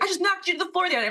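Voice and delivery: high, rushed shocked blurt; shocked, fast. Retold or imitated. Teammate panicking he had knocked her down.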